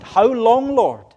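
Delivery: very loud, high cry to God; anguished cry. Acted. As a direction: cry out to heaven at full voice. Preacher acts Isaiah crying to God.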